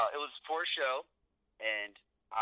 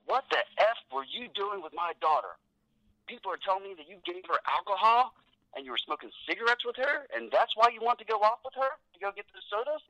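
rising, louder, higher furious father; angry accusation; 10 semitones higher, 4 dB louder than usual. Retold or imitated. Promoter accusing wrestler over his daughter, rising outrage. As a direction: build from accusing to outraged near shouting.

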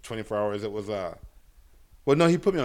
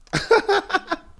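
a sudden high burst of laughter; 6 dB louder than usual. Real, not acted. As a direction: crack up at his own bad luck. Laughs at failed drug test.

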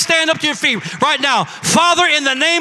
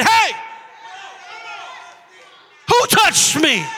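Jesus calling out; 9 semitones higher, slower than usual. Acted. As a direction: call out over the crowd as Jesus. Jesus voiced in story.

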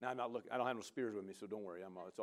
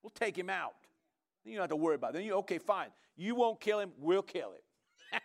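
pushy henchmen; 4 semitones higher, 4 dB louder than usual. Retold or imitated. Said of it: "they said".

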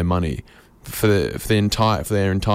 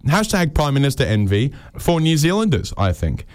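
usual speaking voice

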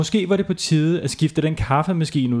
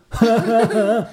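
mocking giggle; 9 semitones higher, 3 dB louder than usual. A narrator's reading. Kids laughing.

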